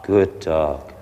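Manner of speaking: low soft praise. Shown as a demonstration. Trainer praising the puppy softly. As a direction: reward the dog gently. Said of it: "So we say".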